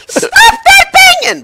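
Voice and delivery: very high, drawn-out shriek; imitated yell. Streamer retelling what he heard next, high imitated yell. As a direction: mimic a fed-up parent yelling, slow and piercing. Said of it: "Next thing I hear".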